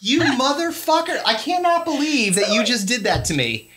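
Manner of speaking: hammed-up indignant accusation; mock outrage. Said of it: "I was like".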